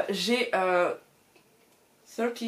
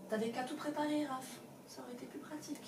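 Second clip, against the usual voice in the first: higher, softer mock reproach; self-scolding inner voice; 4 semitones higher, 10 dB quieter, faster than usual. Booktuber chiding herself for not preparing, soft mock reproach. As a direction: tease yourself like a nagging friend.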